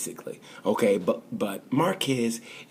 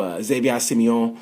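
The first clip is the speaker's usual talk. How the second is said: ordinary talk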